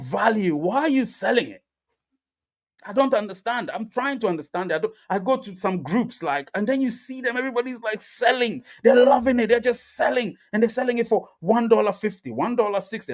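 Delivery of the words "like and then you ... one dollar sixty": much higher, disbelieving; incredulous. Real, not acted. Amazed at people selling cheap, high disbelief. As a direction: sound amazed at what you see.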